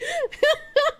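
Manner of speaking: giggling at her own joke; high burst of laughter. Real, not acted.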